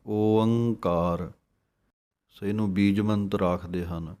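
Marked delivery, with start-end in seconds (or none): 0.1-1.3 ਓੰਕਾਰ with a full open ਓ, very slow; open o sound. Shown as a demonstration. Teacher shows the open ਓ of ਓੰਕਾਰ, slowly. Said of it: "ਊੜੇ ਨੂੰ ਖੁੱਲ੍ਹਾ ਬੋਲਣਾ ਹੈ ਉੱਤੋਂ ਓ".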